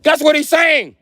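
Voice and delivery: high, loud shout. Retold or imitated. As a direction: yell it out. Imitating brother shouting.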